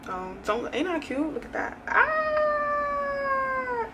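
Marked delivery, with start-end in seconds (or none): 1.9-3.9 long, high-pitched squeal; excited squeal. Real, not acted. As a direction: squeal with delight, holding the note. Showing off her new camera.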